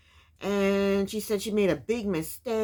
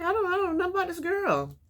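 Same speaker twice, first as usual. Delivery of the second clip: higher, mock-doubtful mother; mother's voice; 9 semitones higher than usual. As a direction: voice the mother, pitched up and unsure. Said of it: "She's like".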